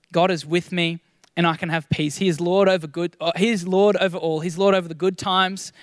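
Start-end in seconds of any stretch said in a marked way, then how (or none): none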